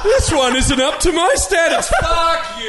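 mock a spoilt fan; spoilt brat. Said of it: "You spoilt brat".

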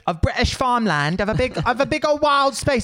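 hammed-up British accent, high. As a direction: play a silly posh British tourist. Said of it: "doing that British accent".

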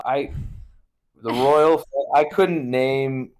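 usual speaking voice

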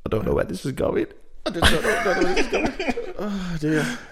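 giggling through the words, voice rising high; cracking up mid-sentence; laughing. Real, not acted. Host losing it on air, laughing through the words.

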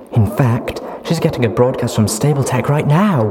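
put-on high, loud, giddy young mare. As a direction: chatter happily about the future. Duct Tape excited.

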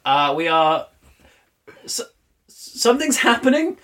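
high, rattled voice over the speakers; nervous pilot losing composure; panicky pilot announcement. Acted. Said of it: "announcement over the speakers".

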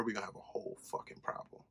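hissed threat under his breath; dead-serious whispered threat; menacing whisper. Real, not acted. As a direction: whisper the warning so it chills. Threat whispered about Katie.

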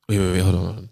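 low, soft voice for a heavy confession; hushed, low put-on solemn friend; grave confiding voice. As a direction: drop it deep and quiet, like big news coming. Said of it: "It's the voice".

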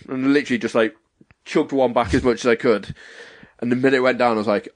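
ordinary talk, nothing marked

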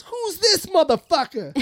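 high-pitched, drawn-out indignation; mock yelling. Emcee demanding who just killed, mock yelling. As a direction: imitate an outraged emcee, voice way up. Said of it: "He was just yelling at Bob".

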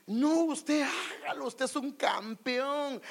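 high, slow, syrupy pep talk; drawn-out mock praise of a champion; slow mock cheerleader. Preacher mimicking a positive friend's slow high flattery. Mocking positive people again.

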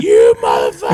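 loud, low furious curse; furious shout. Retold or imitated. Man retelling how he cursed the snake, furious shout. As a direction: spit it out in rage at the snake.

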